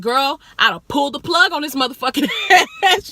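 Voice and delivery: loud, high, laughing through the line; laughing threat. Retold or imitated. Telling her friend she'd pull the plug, loud and laughing. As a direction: joke it out, cracking up. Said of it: "She started dying".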